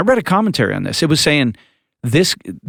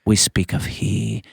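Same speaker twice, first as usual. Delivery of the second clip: put-on a light Scottish accent, slow and lower; a half-hearted Scottish brogue, preacher-like; weak Scottish accent; 4 semitones lower, 4 dB quieter than usual. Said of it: "That was my weak Scottish accent".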